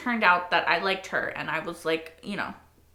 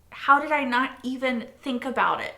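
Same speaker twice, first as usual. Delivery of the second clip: usual speaking voice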